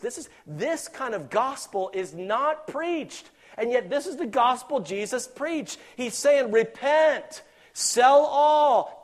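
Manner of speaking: loud, high, slow preacher's cry; preaching at full force. Acted. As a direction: preach it out with fire.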